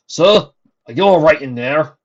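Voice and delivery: loud, high call through a door. Acted. Servant calling through door.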